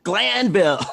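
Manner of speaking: retold exclamation, high and punchy; emphatic exclamation. Both said it turning round at once.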